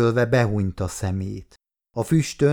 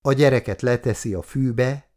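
usual speaking voice

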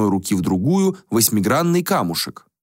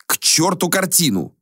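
raised voice; 10 semitones higher than usual. Acted. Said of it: "повысил голос Хобарт".

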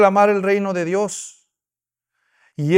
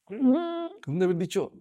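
ordinary delivery

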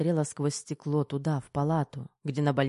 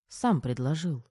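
ordinary delivery, nothing marked

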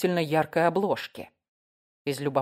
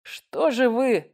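slow, higher-pitched chiding; sergeant's drawn-out reproach; reproachful; 7 semitones higher, much slower than usual. A narrator's reading. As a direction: draw it out in disappointed reproof. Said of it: "укорил сержант".

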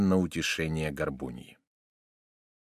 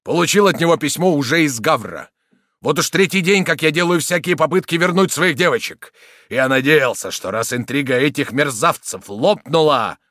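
loud, raised indignant account; agitated rant; 5 semitones higher, 8 dB louder than usual. A narrator's reading. Soldier recounting his failed attempts, agitated. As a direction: pour out the grievance loudly. Soldier rants about the plot.

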